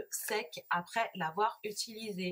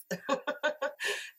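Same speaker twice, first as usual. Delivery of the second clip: embarrassed laugh; 7 semitones higher than usual. Real, not acted. Laughs at her own accent.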